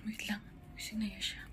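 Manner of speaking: very soft, half-whispered aside; hushed whisper. Real, not acted. Baby sleeping nearby just woke. (pabulong at napakahina)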